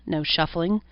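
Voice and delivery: plain reading voice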